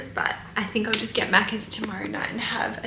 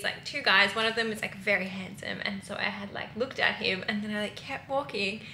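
ordinary delivery

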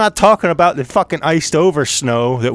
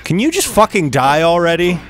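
exasperated; 8 semitones higher, 5 dB louder than usual. Real, not acted. Exasperated at enemy.

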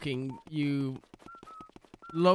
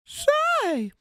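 put-on much higher, sharp old man's "Say!"; Herbert's call. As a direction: call out like a creepy old neighbor. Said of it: "I normally can do it".

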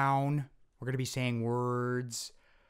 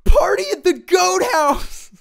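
high, very loud squeaky yell; squeal-shout it; squeaky cartoon shout; 13 semitones higher, 11 dB louder than usual.

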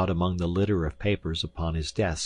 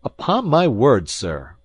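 loud, high indignation; angry cry; 6 semitones higher, 8 dB louder, slower than usual. A narrator's reading. Visitor protests at being doubted, crying out angrily. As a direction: burst out, offended. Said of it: "I cried angrily".

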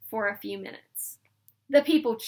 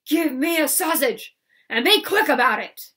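loud, higher bellowing demand; yelling bully; 4 semitones higher, 10 dB louder than usual. A narrator's reading. Laban yelling at the sausage seller, loud bully. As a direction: bark the order at the old man. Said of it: "and yelled".

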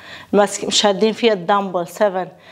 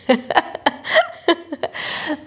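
breathy laugh; 6 semitones higher than usual. Real, not acted. Laughs at failing to pull.

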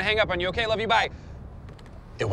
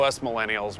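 ordinary talk, nothing marked

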